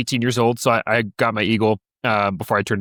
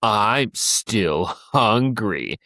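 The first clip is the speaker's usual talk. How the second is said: very slow, lower, deliberate taunt; slow defiance; 5 semitones lower, much slower than usual. Retold or imitated. Little brother looks Tim dead in the eyes, slowly defiant. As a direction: drag it out as a dare. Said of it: "dead in his eyes".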